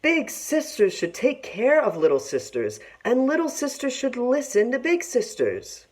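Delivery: a mother pleading with her quarrelling daughters. A narrator's reading. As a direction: beg the girls to get along. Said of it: "Their mother would plead with them".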